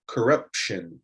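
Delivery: corruption said slowly, each syllable clear; slow model word. Shown as a demonstration. Teacher models corruption for learners, slowly. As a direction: say corruption slowly for students to copy. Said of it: "try those words after me".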